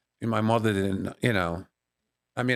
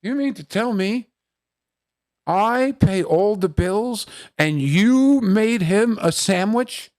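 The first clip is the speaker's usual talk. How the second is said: higher, louder, slow outrage; indignant father; 11 semitones higher, 7 dB louder, slower than usual. Retold or imitated. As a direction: drag it out like a jealous dad. Father indignant about the sandwich.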